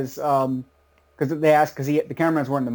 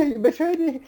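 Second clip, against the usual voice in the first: high, hammed-up cry of betrayal; mock betrayed wife; 11 semitones higher than usual. Co-host quoting Chi-Chi, high mock betrayal. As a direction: squeal it like a wronged wife. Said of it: "Yeah, yeah".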